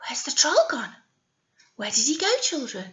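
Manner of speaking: hushed wondering whisper. Real, not acted. Teacher asking the children, whispered. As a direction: whisper it like a secret.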